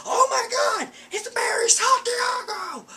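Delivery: shrieking way up high and loud; hammed-up fan shriek; mock fangirl scream. Mocking a fan's shriek.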